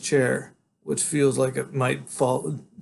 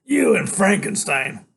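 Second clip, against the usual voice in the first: mocking biker; 7 semitones higher, 6 dB louder, slower than usual. A narrator's reading. Biker mocks.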